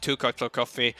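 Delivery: usual speaking voice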